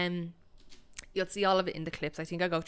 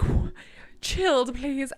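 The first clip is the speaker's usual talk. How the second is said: flustered, startled answer; 7 semitones higher, 6 dB louder, much slower than usual. Retold or imitated.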